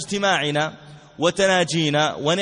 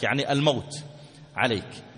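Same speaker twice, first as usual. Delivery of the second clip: ordinary talk, nothing marked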